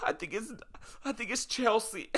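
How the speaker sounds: high, soft weepy squeak; hammed-up crying breaking into laughter; mock tearful. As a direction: stammer it out through fake tears. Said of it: "start bawling my eyes out".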